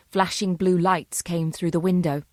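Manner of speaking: ordinary delivery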